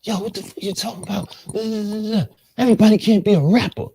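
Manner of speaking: high-pitched mock tirade, building to loud; imitated angry rant. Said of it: "he starts going off".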